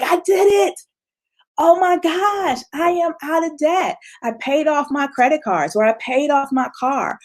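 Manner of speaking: high, thrilled cry of victory; excited triumph. Pretend. Imagined self celebrating being out of debt, excited and high. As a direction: gush like you just paid off every debt.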